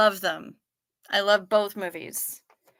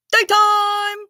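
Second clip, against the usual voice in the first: Daytime! cried high and loud; high loud cry; 12 semitones higher, 8 dB louder than usual. Pretend. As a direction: yell Daytime! much higher and louder. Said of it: "it's the nighttime, daytime one".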